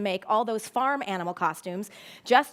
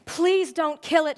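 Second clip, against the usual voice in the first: raised, pleading cry; pleading sob; 5 semitones higher, 6 dB louder than usual. A narrator's reading. Girl begging her father to spare the pig, tearful. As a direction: beg through tears. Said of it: "she sobbed".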